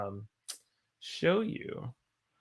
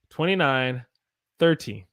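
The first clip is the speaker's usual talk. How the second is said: usual speaking voice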